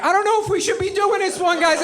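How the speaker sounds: very high, anxious whine; acted nervous tagalong piping up; timid worrier. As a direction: pitch it way up, fretful. Apple Maps frets from the back.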